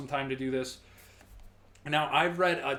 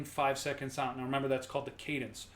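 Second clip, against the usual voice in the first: ordinary delivery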